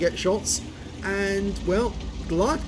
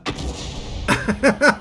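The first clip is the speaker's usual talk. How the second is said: sudden laugh; 4 semitones higher, 3 dB louder than usual. Real, not acted. Laughs at a wipeout.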